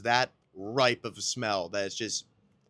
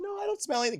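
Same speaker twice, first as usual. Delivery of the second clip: much higher, sweet mock denial; imitating polite nurse; much faster than usual. Nurse politely pretending not to smell his breath, high mock voice. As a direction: squeak out a too-polite denial. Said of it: "she's like".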